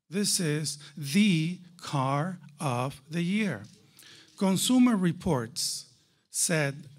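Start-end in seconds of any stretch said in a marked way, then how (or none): 0.1-3.6 slow, weighty stress on THE. Real, not acted. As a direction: set THE apart, word by word. Emphatic contrast with alternative titles.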